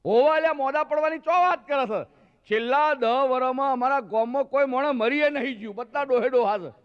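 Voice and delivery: high, loud mocking villager; scoffing village uncle. Uncle scoffing at the new doctor, loud and high. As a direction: laugh off the young doctor.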